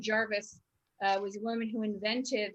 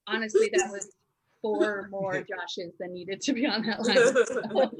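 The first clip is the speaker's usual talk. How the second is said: real laugh while talking; 5 dB louder than usual. Real, not acted. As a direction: giggle out the words. Laughing at her own joke.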